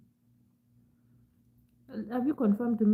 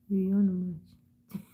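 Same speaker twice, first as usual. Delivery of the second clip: very slow, stretched-out words; much slower than usual. Real, not acted. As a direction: drag the words out. Drawn-out yawn word.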